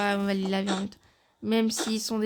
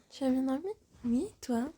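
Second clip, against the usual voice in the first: ordinary delivery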